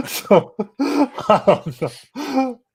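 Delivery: laughing through the words. Real, not acted. Host laughing at a baffling scene, laughing slowly. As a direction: giggle it out, slowly.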